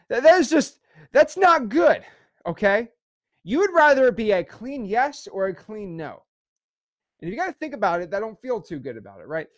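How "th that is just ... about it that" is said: emotional. Real, not acted. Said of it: "I get real emotional about this".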